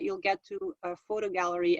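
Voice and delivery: ordinary talk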